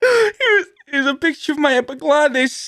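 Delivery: very high, strained put-on voice; high-pitched comic show-and-tell; proud wallet-photo oddball. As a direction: present it in a squeaky character voice. Acting out showing a wallet photo.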